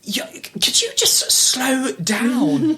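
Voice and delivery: high, very slow mocking agent; imitating agent. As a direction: drag it out like a fussy agent. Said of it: "when she went".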